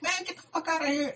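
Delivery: very high, thin-volume imitation scream; mock scream. Homegirl screams at the dude to leave, mock scream. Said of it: "hella screamed at him".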